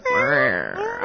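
high, soft rolled cat trill; throaty feline rrr; cat growl. Shown as a demonstration. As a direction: purr out a rolling growl. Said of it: "I can do a cat".

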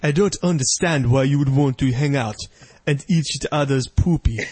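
hammed-up African pastor impression; mock Nigerian accent. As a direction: do a puzzled Nigerian preacher's accent. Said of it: "I don't do a very good African voice".

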